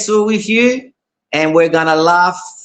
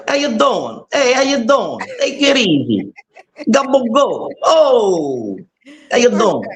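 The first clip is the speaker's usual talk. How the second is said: put-on Jersey accent; 5 semitones higher than usual. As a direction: play your New Jersey cousins greeting you, Jersey accent. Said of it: "I gotta do my Jersey accent for you".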